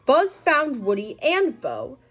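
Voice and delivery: usual speaking voice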